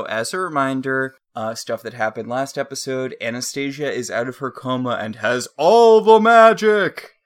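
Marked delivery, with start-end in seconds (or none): 5.6-7.1 excited exclamation. Real, not acted. Excited recap.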